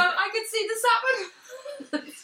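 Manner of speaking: slow mock wail of discovery; imitating friend. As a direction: parrot her slow surprised cry. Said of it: "take off a blindfold and go".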